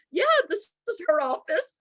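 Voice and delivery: high-pitched pretend receptionist; pretending office staff. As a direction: play along as someone else on the phone. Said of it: "So I pretended like".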